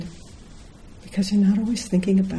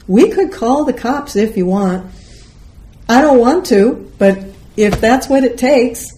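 raised, higher, testy insistence; edge in the voice; 6 semitones higher, 6 dB louder than usual. Retold or imitated. Woman threatening to call the cops, with an edge. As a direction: press the point with an edge. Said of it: "I had an edge in my voice".

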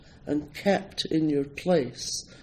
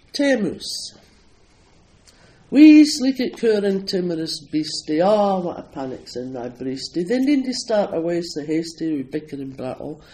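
Scottish poem recital; 2 semitones higher, 6 dB louder than usual. Acted. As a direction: declaim the poem in thick Scots dialect. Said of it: "I do stand-up performances of his poetry".

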